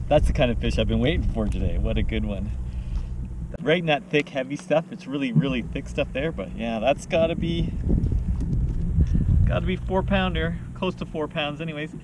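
ordinary delivery

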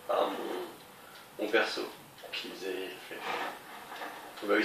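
normal talking voice

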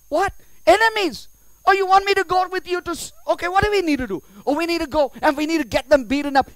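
high, loud, fast flurry; mock alarm. Asked to go beat up enemies, mock excited alarm. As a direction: get worked up in pretend readiness for a fight. Acts roused to fight enemies.

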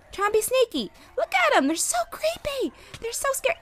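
put-on high cooing baby voice; high sing-song cooing at the monsters; baby talk. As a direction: coo at them like tiny pets. Cooing at the little mobs.

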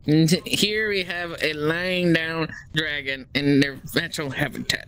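slow, hushed wildlife narrator; nature documentary. Pretend. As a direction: present the dragon like a nature show. Showing avatar like wildlife film.

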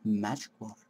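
low, soft item name; hushed reading. Acted. Reading out the item found, low and hushed. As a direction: say it low and very softly. First take, read quietly.

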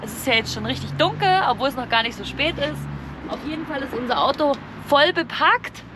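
ordinary talk, nothing marked

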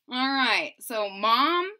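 usual speaking voice

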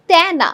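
তেনা with open "æ", as tæna; open vowel. Shown as a demonstration. Teacher demonstrates the open pronunciation, open vowel. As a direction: stretch তেনা with the vowel of "cat". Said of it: "এ এর মতো উচ্চারণকে বোঝানো".

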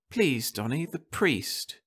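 anxious wife at the sickbed; Martha's voice. A narrator's reading.

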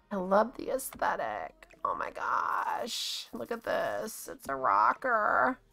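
whisper. Real, not acted. Whispers over cute furniture.

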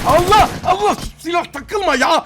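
exasperated gamer shout; frustrated outburst. Real, not acted. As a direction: yell at the stuck weapon, then drag it out. Gun jams mid fight.

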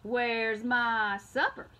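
slow, loud demanding mule; talking mule. A narrator's reading. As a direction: drawl it out like a hungry mule. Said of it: "asked the mule".

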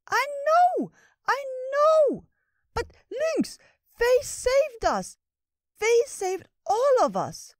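very high, loud, slow, tearful little creature; squirrel voice pleading. Acted. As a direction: plead desperately in a tiny high voice. Ratatosk pleading, crying.

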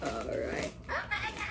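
ordinary talk, nothing marked

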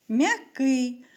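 ordinary talk

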